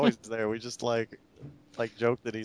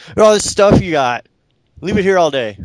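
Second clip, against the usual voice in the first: nagging parent; 7 semitones higher, 4 dB louder than usual. Retold or imitated. Parent voiced in a story.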